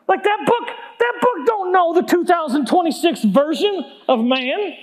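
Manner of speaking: hammed-up dismissive whine; scoffing protest. Mocks wife's guidebook as outdated.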